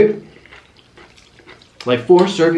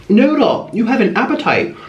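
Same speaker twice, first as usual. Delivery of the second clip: sing-song pet call; 8 semitones higher than usual. Real, not acted. Cooing at the pet sloth.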